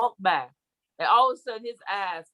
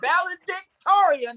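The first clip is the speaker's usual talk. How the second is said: proud; 11 semitones higher, 6 dB louder, slower than usual. Pretend. Proud mock boast.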